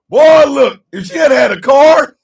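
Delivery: high, loud excited outburst. Real, not acted. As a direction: shout it out in disbelief.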